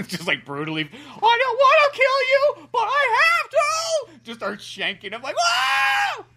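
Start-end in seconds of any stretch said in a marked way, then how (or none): 0.8-4.2 very high, slow, shouted cartoon frog voice; reluctant killer. Acting out Slippy stabbing uncle. 5.4-6.2 shrieked very high cartoon whoa; a squeaky cartoon yelp of shock. Acted cartoon frog exclaiming.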